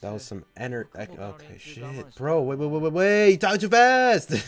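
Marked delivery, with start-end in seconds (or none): 2.1-4.3 louder, climbing protest; flustered outburst. Real, not acted. Overwhelmed by fast subtitles.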